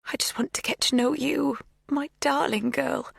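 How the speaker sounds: tender plea. A narrator's reading. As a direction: coax her gently, almost a whisper. Magda pleading tenderly.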